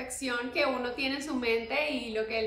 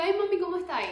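casual Zulian greeting; 6 semitones higher, 3 dB louder, faster than usual. Retold or imitated. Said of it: "yo le digo".

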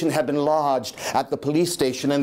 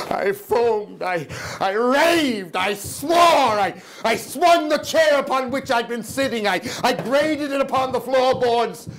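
higher, frantic raving narration; 5 semitones higher than usual. Acted. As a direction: rave it out, near shouting.

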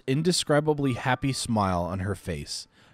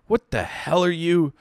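higher, louder outburst; angry doctor; 9 semitones higher, 6 dB louder than usual. Acted. Doctor yelling at Chaim, furious outburst. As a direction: fling the question in fury.

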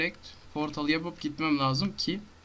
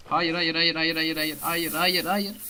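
rising, louder frantic no no no; panicked no; 4 semitones higher, 5 dB louder than usual. Real, not acted. Gamer yelling at his screen in panic. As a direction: beg the game not to happen.

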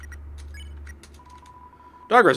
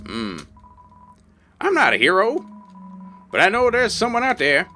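put-on old prospector; 5 semitones higher than usual. Reads NPC lines in character.

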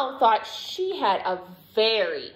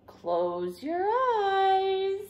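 very slow, high singsong; playful build-up; 10 semitones higher, much slower than usual. A narrator's reading. Dad telling Douglas to close his eyes, slow teasing singsong. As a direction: draw it out high for suspense.

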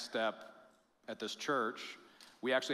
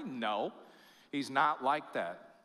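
ordinary talk, nothing marked

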